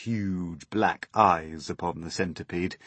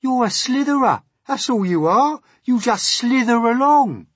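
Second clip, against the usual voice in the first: very high, loud taunt; jeering centipede; 12 dB louder than usual. Acted. Centipede insulting earthworm's movement, jeering shout.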